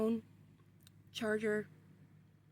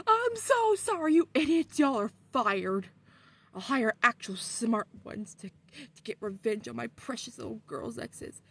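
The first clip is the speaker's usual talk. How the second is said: furious boss; 5 dB louder than usual. Retold or imitated. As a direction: fire the idiots furiously. Dad firing the demons.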